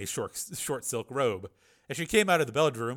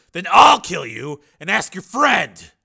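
slow, loud, higher-pitched threat; menacing threat; 7 semitones higher, 15 dB louder, slower than usual. Acted. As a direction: threaten hard, taking your time. Threatening to kill a captive.

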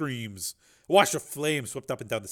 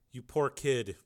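gentle pity; much slower than usual. A narrator's reading. Borodini comforts crying girl.

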